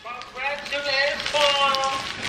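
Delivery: high, drawn-out cheer; cheery congratulations. Real, not acted. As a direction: sing out the congratulations. Opening cheer at graduation video.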